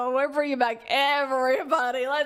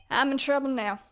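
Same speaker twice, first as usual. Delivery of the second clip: usual speaking voice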